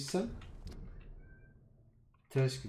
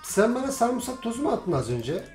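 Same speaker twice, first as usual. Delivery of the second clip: higher, louder offended pleading; indignant protest; 8 semitones higher, 3 dB louder than usual. Acted. Player protesting to NPCs who attacked him, loud indignant. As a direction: protest your innocence loudly.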